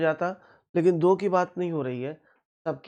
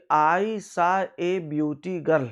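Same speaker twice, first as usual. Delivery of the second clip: slow wrong example; 4 dB louder, much slower than usual. Shown as a demonstration. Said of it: "beautiful बोलना पड़ेगा".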